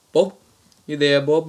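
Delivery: loud mock-Australian prank voice; Australian accent. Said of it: "same Australian accent".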